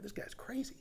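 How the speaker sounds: a hushed aside, This guy's crazy; whispered thought. Retold or imitated. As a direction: whisper it like a scared kid thinking. Child's thought in the dark.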